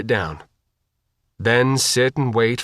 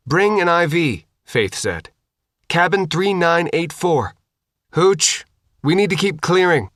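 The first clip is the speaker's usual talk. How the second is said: brisk soldier; 6 semitones higher than usual. Acted. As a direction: snap out commands to the team. Faith giving orders.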